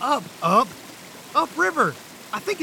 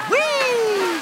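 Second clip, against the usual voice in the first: whee; 7 semitones higher, 3 dB louder than usual. Acted. Cheering the fall.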